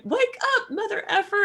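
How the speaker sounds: high, loud mock wake-up yell; Samuel L. Jackson. Voice assistant rousing a sleeper, hammed-up loud yell. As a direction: yell it like a rude human alarm clock. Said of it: "Samuel L. Jackson to wake you up".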